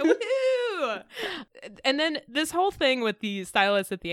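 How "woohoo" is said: high, laughing woohoo; mock cheer. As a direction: whoop playfully with a big pitch jump. Cheering the throuple idea.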